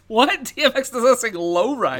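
high, loud disbelief; can't believe what they heard; incredulous. Real, not acted. Incredulous reaction.

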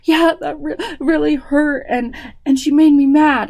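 put-on high, loud tearful little girl; upset child. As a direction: whine it like an upset four-year-old. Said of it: "child answers, retold".

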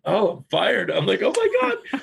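high, loud mock dismay; mock shock. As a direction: exclaim in exaggerated disbelief. Reacting to being fired, acted.

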